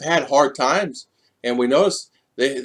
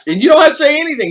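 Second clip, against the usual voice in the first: fast, higher, laughing through the words; laughing while talking; 14 semitones higher, 7 dB louder, much faster than usual. Real, not acted. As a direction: rush it out laughing. Laughing while telling story.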